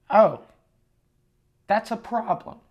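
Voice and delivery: high-pitched, awkward understatement; mock-polite dismay. Guest told the hotel is sold out, mock-polite dismay.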